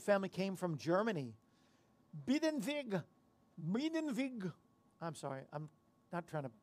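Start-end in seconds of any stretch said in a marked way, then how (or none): 2.3-3.0 German name said with a German accent, pitched up. Shown as a demonstration. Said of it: "I love the German accent". 3.7-4.5 second try at Biedenweg in a German accent. Shown as a demonstration. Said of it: "I love the German accent".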